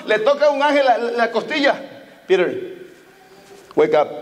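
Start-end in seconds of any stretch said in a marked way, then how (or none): 2.3-4.1 low, hushed English angel. Retold or imitated. Angel waking Peter in jail, in a low hushed voice. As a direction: nudge the sleeper awake from far down.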